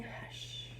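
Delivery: soft breathy whisper of hush. Acted. Teacher voicing the old lady's bedtime hush, whispered. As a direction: breathe the word out like a shush. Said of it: "a quiet old lady who was whispering".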